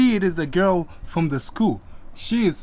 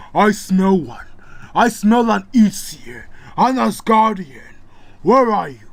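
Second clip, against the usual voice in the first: growling wolf man; 4 semitones higher, 6 dB louder than usual. Acted. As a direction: sniff out your prey, threatening.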